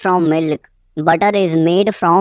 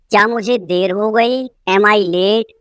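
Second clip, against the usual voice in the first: usual speaking voice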